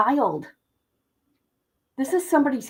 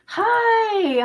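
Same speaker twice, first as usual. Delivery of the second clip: mock greeting; 10 semitones higher than usual.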